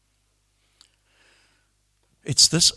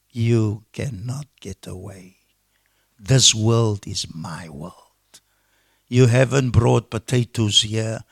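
preacher voicing God's solemn reproach; God's words; 2 semitones lower than usual. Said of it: "God is saying".

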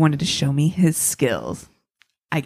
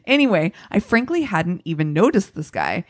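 usual speaking voice

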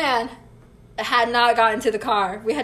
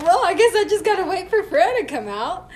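high, laughing through the line; 8 semitones higher, 5 dB louder than usual. Retold or imitated. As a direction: chuckle at your own situation. Retold thought with laugh.

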